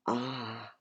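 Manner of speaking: plain reading voice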